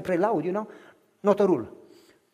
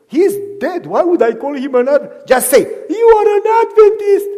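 put-on high, loud boyish protest; puzzled child; 9 semitones higher, 9 dB louder than usual. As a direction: object like a confused little boy. Child at the grave.

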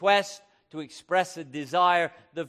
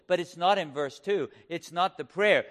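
normal talking voice

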